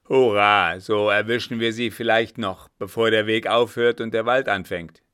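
loud, eager hurrah; triumphant cheer. A narrator's reading. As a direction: call it out, rallying for the chase. Officer cheers at chance to catch them. (laut jubelnd)

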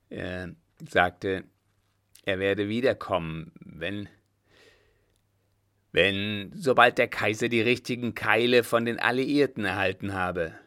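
long hesitations, then a loud fast rush; stall, then burst out with it; halting, then blurting. Acted. Coachman hesitates, then blurts out insult. (erst stockend, dann laut herausplatzend)

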